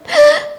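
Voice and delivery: sharp high gasp of surprise. Acted. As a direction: gasp high and sudden at the sight. Boy sees crocodile in basket.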